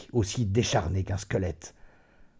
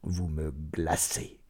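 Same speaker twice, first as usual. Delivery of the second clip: low, soft, very slow and cold; indifférence effrayante; 5 semitones lower, 7 dB quieter, much slower than usual. A narrator's reading. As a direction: chill her with slow indifference. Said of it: "avec une indifférence qui eut quelque chose d'effrayant". (froide indifférence, voix basse et lente)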